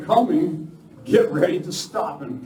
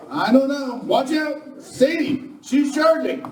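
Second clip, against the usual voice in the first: higher, urgent commands; urgent battle alarm; 10 semitones higher than usual. Acted. Defender warning his men as Sadie charges, urgent. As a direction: bark out orders under attack.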